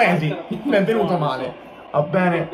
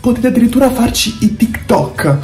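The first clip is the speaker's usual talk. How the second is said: ad read; 8 dB louder than usual. Acted. Fake band ad at half-time.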